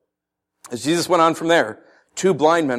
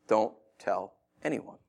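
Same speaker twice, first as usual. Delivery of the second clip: slow, softer, measured order; stern warning; 5 semitones lower, 10 dB quieter, slower than usual. Acted. Jesus warning the healed men after opening their eyes, stern. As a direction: space the words out firmly and quietly. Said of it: "he warns them sternly".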